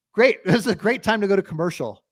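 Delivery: sarcastic, exasperated cheer; sarcastic enthusiasm. Retold or imitated. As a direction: fake being thrilled at the timing. Mocks the badly timed ad break.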